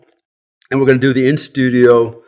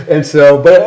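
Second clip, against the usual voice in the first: laughing; 5 semitones higher, 6 dB louder than usual. Real, not acted. Laughing at his own joke.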